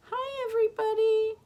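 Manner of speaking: very high, squeaky hello; puppet-like greeting voice. Pretend. George and Martha say hi to listeners, high character voice. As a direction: greet them in a tiny high voice. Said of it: "Say hi".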